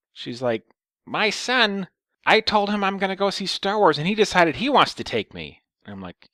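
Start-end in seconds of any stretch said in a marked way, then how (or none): 1.1-5.6 high, loud mock old woman; caricatured old lady, pitched way up. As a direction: explain insistently as an old lady. Old lady explains, retold.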